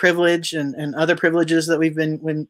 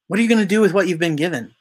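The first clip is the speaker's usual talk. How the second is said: fast repeat; much faster than usual. Shown as a demonstration. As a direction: rattle it off quickly, pressing the point. Repeats the question rapidly.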